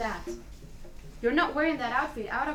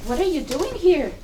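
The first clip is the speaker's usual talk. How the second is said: startled; 5 semitones higher, 6 dB louder than usual. Acted. Sees dead friend appear.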